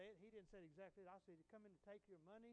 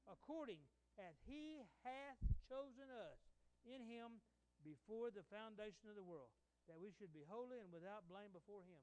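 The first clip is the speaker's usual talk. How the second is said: solemn scripture reading; 7 dB louder than usual. Real, not acted. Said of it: "what Scripture says".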